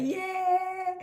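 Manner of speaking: very high, drawn-out squeal; mock elation. Cook replaying her happiness at the praise, mock elation. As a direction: squeal it out in hammed-up triumph. Repeats her happy cheer.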